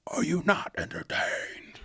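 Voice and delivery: slow, hushed movie-quote voice; drawn-out, soft gladiator imitation. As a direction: deliver it slowly as a breath-only mock gladiator cry. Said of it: "Like Gladiator".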